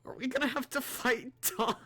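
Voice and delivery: high, loud worried whine; whiny. Real, not acted. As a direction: whine the question. Dreading fighting Dawn.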